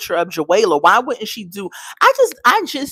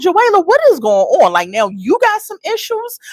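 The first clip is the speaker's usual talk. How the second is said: high-pitched exasperated scolding; exasperated disbelief; 11 semitones higher than usual. Retold or imitated. Host calling out Joella over her mess, exasperated. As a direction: call her out in a raised, incredulous pitch.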